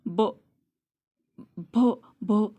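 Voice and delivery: stuck on the first sound, repeating it; mock stammer of a frightened child. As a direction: stammer as a scared shy girl. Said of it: "इस बेचारी को stammer भी हो रहा था".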